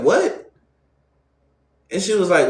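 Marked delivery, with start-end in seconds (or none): 0.0-0.5 high, rising shocked what; stunned what. Retold or imitated. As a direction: leap up in disbelief. Reacting to marriage news.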